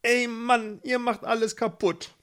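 high, whiny annoyance; sour. A narrator's reading. Gustav scolds his meddling brothers, high and cross. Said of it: "Gustav war sauer".

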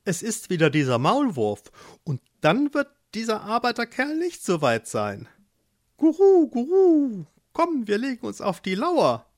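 hohes, lautes Guru Guru, aufgeregt; gurrende Tauben. Acted. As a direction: gurren wie nervöse Tauben im Garten. Tauben tuscheln. (Tauben im Hexengarten sehen die Erde sich bewegen, hoch und gurrend)

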